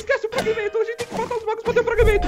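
high, frantic instructions; panicked plea. Real, not acted. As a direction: urge help while under attack. Attacked by mages. (voz aguda e aflita)

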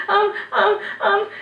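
put-on high, halting boy's stammer; nervous stammer. A narrator's reading. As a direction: squeak out frightened ums. Boy caught, stammering.

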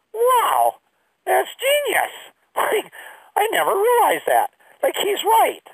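way up high, amazed put-on voice; mock dazzled awe. Teen self reacting to the advisor, high mock amazement. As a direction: gush like it's the best idea ever.

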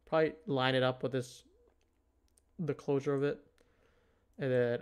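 ordinary talk, nothing marked

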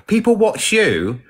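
high, slow, drawn-out put-on line; Kerry impression. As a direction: quote it slowly, pitched well up. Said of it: "I'm gonna be like Kerry".

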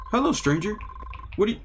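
high, lifted voice for the stranger; blind man voice. Acted. Stranger greeting the lost driver, lifted voice. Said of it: "blind man".

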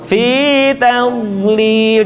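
FITAMLIL very slowly, middle sound held; soft dad. Shown as a demonstration. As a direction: sustain the cushioned AM before LIL. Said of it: "suaranya empuk". ("Fitamlil" empuk, dipanjangkan)

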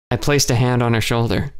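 ordinary delivery

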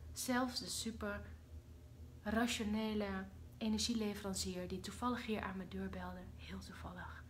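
soft secret whisper; hushed gossip; whispered aside. Real, not acted. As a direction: let them in on it. Confiding aside.

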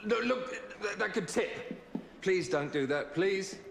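high, urgent, panicked plea; alarmed pleading. Acted. Begging someone on a table that could tip, panicked pleading. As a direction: beg loudly, pitch leaping up in alarm.

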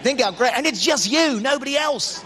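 normal talking voice

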